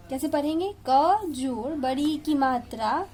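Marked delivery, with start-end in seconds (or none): none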